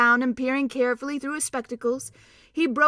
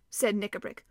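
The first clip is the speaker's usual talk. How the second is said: ordinary delivery, nothing marked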